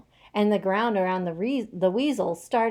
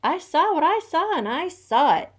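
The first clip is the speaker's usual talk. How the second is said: high, loud, sly insistence; a sly weasel protesting too much; lying weasel; 10 semitones higher, 8 dB louder than usual. A narrator's reading. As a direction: swear to it like a shifty weasel. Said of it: "lied the weasel".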